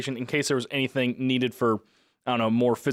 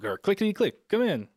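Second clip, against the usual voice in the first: high clicking alien speech; click language; 8 semitones higher than usual. Retold or imitated. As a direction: chatter the words in clicks. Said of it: "you're speaking, like, in, in the, the click language".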